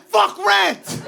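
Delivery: high, shouted dismissal; hammed-up, punchy high shout; mock salesman yell. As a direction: imitate a salesman hollering down an objection. Salesman yells down objection.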